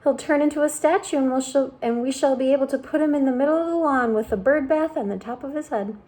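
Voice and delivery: mournful worm relishing doom; earthworm voice. A narrator's reading.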